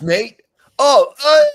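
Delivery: squeaky put-on British voice; mock British accent. Joining the mock British bit.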